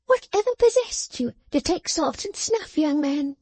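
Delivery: high, tart voice of a small scolding woman; sharp little dressmaker. Acted. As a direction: needle the sorry young man. Jenny's voice.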